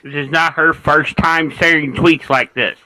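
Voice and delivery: loud, slow, insistent. Real, not acted. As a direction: press the point over others. Heated panel argument.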